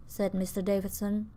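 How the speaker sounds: ordinary delivery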